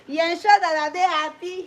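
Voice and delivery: ordinary talk, nothing marked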